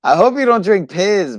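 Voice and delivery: can't believe it, pleading; incredulous. Real, not acted.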